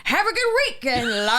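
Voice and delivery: hammed-up Irish sign-off; attempted Irish accent. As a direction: put on a badly faked Irish accent. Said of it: "Come on, [name], one more time".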